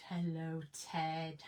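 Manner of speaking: usual speaking voice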